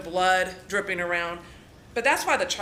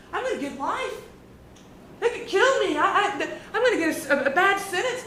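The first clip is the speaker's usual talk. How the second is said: high, whiny mock complaint; panicked suspect; 10 semitones higher than usual. Imitating him telling Courtney on the phone, high panicky whine. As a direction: pitch it way up, stammering in mock panic. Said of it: "He keeps telling Courtney on the phone".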